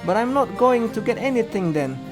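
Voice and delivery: high, loud objection; indignant protest. A narrator's reading. Protesting a hard bargain.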